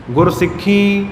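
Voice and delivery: ਸਿੱਖੀ ending in nasal "een"; ਸਿੱਖੀ with held nasal ee. Shown as a demonstration. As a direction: send the last ee of ਸਿੱਖੀ through the nose. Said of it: "ਨਾਸਕੀ ਉਚਾਰਨ ਹੋਵੇਗਾ ਨੱਕ ਰਾਹੀਂ".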